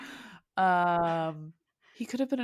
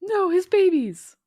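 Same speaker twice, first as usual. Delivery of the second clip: dismayed cry; 9 semitones higher, 9 dB louder than usual. Real, not acted. Dismay at the story.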